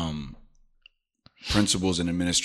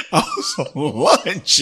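laughing; 9 semitones higher, 6 dB louder, slower than usual. Real, not acted. Laughing while retelling excuse.

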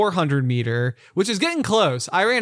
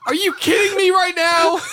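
very high mock-outraged yell; comic, anguished holler; exasperated shout; 14 semitones higher, 4 dB louder than usual. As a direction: shout in disbelief at a gadget. Acted outburst at Alexa.

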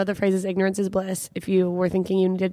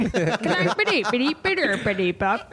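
Swedish chef gibberish. Retold or imitated. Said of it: "you went there with the Swedish chef".

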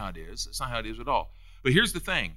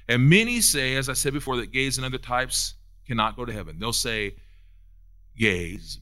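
ordinary talk, nothing marked

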